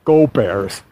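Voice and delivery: broad Chicago sports fan accent. Shown as a demonstration. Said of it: "I read it in a Chicago accent".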